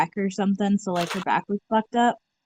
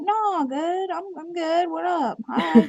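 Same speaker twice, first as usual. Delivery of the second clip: high-pitched nervous reassurance; high awkward voice; 8 semitones higher, slower than usual. Retold or imitated. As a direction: squeak it out awkwardly.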